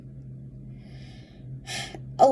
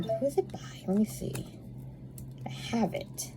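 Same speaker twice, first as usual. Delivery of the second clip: whisper; 2 semitones higher, 10 dB quieter than usual. Real, not acted. Muttering to herself while searching.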